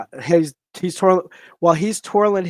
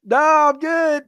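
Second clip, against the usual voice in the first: loud, high imitated brush-off; called-back refusal; 10 semitones higher, 6 dB louder, much slower than usual. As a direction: holler it back, pitched way up. Retold reply called back.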